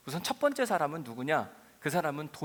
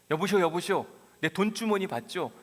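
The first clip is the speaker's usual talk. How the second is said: loud calling out; 5 semitones higher, 7 dB louder than usual. Acted. Traveler shakes sleeper awake, demanding money.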